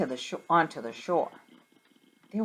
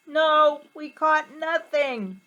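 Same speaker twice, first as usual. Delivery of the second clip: yelled back; 9 semitones higher, 5 dB louder, slower than usual. Acted. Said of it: "So they yelled back".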